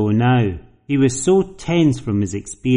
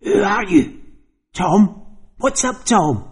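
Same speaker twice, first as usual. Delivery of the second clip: high, rasping voice of someone else; rasping possessed voice; 9 semitones higher than usual. Acted. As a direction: rasp it out like a stranger inside him. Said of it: "spoke with a rasping voice that seemed to come from someone else".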